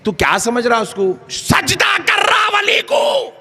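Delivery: loud, high, slow accusation; accusing shout. Retold or imitated. Opponent accusing the devotee of prostration, accusing shout. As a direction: shout the charge.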